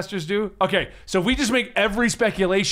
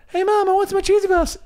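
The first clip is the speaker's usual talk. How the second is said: put-on high kid voice; 12 semitones higher than usual. Mocking kid commenter voice.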